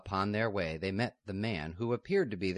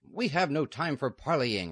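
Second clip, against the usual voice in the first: loud, higher rough impatient man; 8 semitones higher, 6 dB louder than usual. Acted. Outlaw losing patience at the gate, rough and loud. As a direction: bark it through the gate. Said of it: "a rough voice said".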